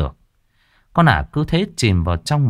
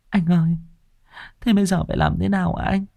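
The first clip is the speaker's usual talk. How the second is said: put-on high, tearful young wife; whimpering; 11 semitones higher, 3 dB quieter, faster than usual. Wife to husband, whimpering high. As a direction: plead on the verge of tears. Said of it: "mếu máo hỏi chồng".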